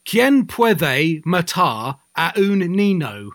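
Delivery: slow, heavy English tourist Spanish, each word flattened; mocking an English tourist mangling Spanish; English-accented Spanish. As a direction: Quien puede matar a un niño in a clumsy Brit accent, drawn out. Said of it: "He would say".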